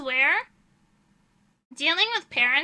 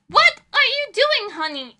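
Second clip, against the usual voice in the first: high, loud parent's exclamation; shocked parent; 9 semitones higher, 7 dB louder than usual. Retold or imitated. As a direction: imitate an appalled parent, pitched up. Retold parent scolding their child.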